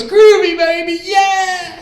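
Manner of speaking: very high, loud, drawn-out mock British shout; Austin Powers. Stranger turning round in Times Square, hammed-up British catchphrase shout. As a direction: belt it like a swinging British spy. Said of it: "was that Austin Powers?".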